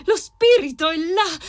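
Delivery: ordinary delivery, nothing marked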